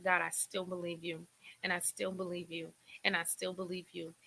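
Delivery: soft, steady repeated vow; quiet prayer. Retold or imitated. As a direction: speak softly to God, holding on to faith. Retold quiet prayer of faith.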